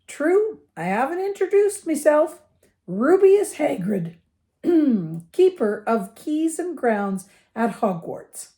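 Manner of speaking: high, hearty giant's voice; Hagrid. A narrator's reading. Giant introduces himself to the boy, hearty and proud.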